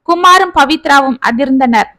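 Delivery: usual speaking voice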